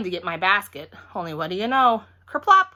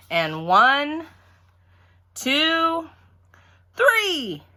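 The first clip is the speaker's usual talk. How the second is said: rising higher with each number; building countdown; 9 semitones higher, 5 dB louder, much slower than usual. A narrator's reading. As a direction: count up to the start.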